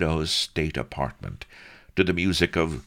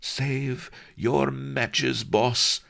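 chortling; 11 semitones higher, much slower than usual. Acted. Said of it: "chortled the chamberlain".

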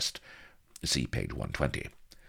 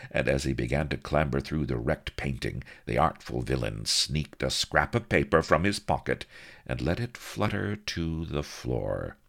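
plain reading voice

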